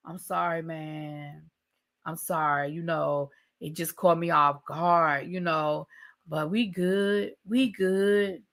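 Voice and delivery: low, soft, lazy mock apology; half-hearted apology. Boyfriend shrugs off her crying in the closet, soft lazy mumble. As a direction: brush it off like it's nothing.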